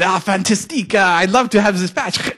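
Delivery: high, loud, delighted mock-French; comic Frenchman, thrilled and loud; French accent. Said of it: "do this in a French accent".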